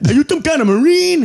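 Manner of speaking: loud, high, slurred yell; high-pitched imitation of an agitated kid; imitated impaired speech. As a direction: yell it high with thick, clumsy consonants. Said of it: "he sounded mentally handicapped".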